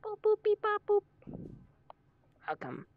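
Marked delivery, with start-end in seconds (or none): 0.0-1.0 very high, loud robot beeping; robot beeps. Acted. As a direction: beep it like a little robot. Greeting a joining player.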